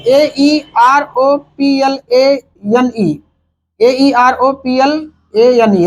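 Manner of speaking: slow letter-by-letter spelling. Shown as a demonstration. Teacher spelling out the word. (धीरे-धीरे एक-एक अक्षर)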